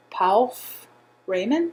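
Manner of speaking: "Paul F-" slow, then "Raymond" apart; sounding it out. Shown as a demonstration. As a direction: break the name after the F sound, questioning. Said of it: "spelled like you would spell Ralph".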